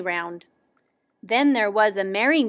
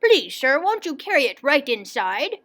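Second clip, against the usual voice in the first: lightly voiced eager gentleman; eager cry; 3 semitones higher than usual. A narrator's reading. Willoughby asks the delivery man inside, eagerly crying out. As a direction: ask with mild excitement. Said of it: "he cried".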